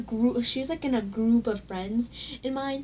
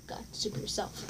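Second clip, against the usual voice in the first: whispered insult under the breath; muttered under breath; 3 semitones lower, 12 dB quieter than usual. Retold or imitated. Retelling a muttered insult at the classmate, whispered. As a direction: hiss it so she can't hear. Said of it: "mumbling on my, under my breath".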